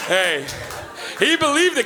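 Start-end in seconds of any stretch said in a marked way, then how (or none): none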